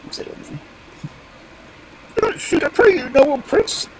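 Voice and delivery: high squeaky bird plea; high-pitched imploring bird voice; pleading stork. Acted. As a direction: beg for your life as a piping stork.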